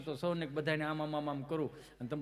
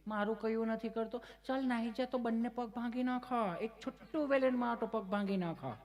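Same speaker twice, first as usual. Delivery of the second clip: a touch of an angry auntie threatening the dog; mock anger; 6 semitones higher than usual. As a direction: scold the dog in a hammed-up auntie voice. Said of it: "એટલો ગુસ્સો આવી ગયો".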